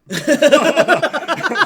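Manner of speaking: big high cackle at his own joke; loud burst of laughter. Real, not acted. Firefighter cracking up at his cop joke, loud laugh.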